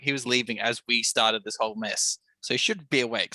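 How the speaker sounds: ordinary delivery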